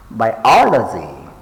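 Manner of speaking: bi-OL-ogy, punching OL; stress on second syllable. Shown as a demonstration. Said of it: "pay attention to the stress syllables".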